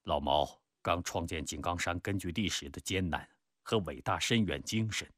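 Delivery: low-key, subdued reminiscence; reflective Peng Dehuai. Acted. Peng recalling Old Mao's hardships, reflective.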